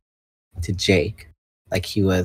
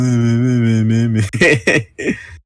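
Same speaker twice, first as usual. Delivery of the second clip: laughing; 2 semitones higher, 6 dB louder than usual. Real, not acted. Laughing at own voice.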